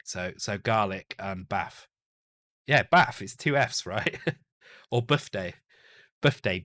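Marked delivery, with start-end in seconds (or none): 2.7-3.2 bath said as baf, short a, f sound at the end; northern bath. Shown as a demonstration. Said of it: "I put an F in it and not a T H". 4.9-6.6 birthday said buffday, short u, f in place of th. Shown as a demonstration. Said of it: "B U F F D A Y".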